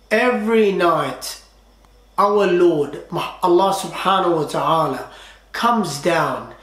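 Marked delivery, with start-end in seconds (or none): none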